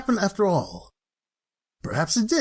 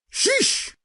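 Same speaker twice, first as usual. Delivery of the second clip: sharp high squeak of annoyance; exasperated; 12 semitones higher than usual. Acted. Scrooge huffing at people who can't take a joke, exasperated. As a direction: toss it off, put out.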